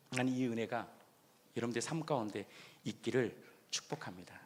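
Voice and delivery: ordinary talk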